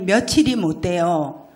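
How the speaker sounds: ordinary talk, nothing marked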